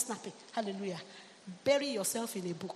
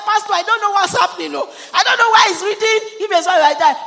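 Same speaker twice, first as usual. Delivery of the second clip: loud, high-pitched mock astonishment; amazed retelling; 9 semitones higher, 14 dB louder than usual. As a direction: shout it like an astonished mother. Retold mother reporting in amazement.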